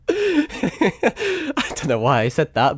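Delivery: high giggle running into the words; laughing. Real, not acted. Streamer cracking up at his own comment, laughing. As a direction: laugh while getting the words out, slowly.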